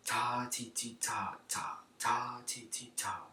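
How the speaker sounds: whispered counting; whispering. Shown as a demonstration. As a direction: whisper the syllables, piano. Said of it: "from piano to mezzo piano".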